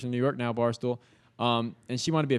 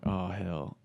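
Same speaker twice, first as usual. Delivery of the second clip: soft, low "Oh, hell."; muttered dismay; 6 semitones lower, 7 dB quieter than usual. Retold or imitated. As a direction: mutter it quietly to yourself. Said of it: "I'm like".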